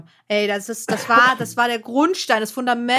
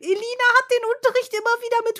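much higher squealing disbelief; shocked high voice. Real, not acted. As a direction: squeal it in mock horror. Shocked reading of report.

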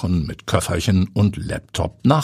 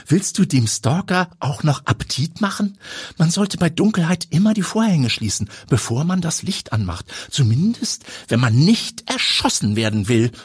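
firm, lecturing warning in a higher woman's voice; stern; 8 semitones higher than usual. A narrator's reading. Pia warns Heidi about the stalker, mildly stern. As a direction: set her friend straight, a touch stern. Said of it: "sagt Pia streng".

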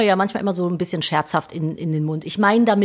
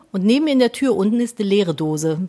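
ordinary talk

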